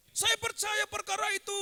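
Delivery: normal talking voice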